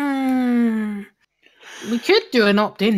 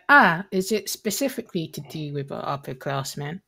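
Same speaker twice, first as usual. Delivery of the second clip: Kalima; 6 semitones lower than usual. Acted. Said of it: "Kalima would be like".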